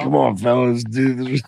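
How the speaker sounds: put-on loud slurred drunk guy; hammed-up drunk pleading; drunk Rogan impression.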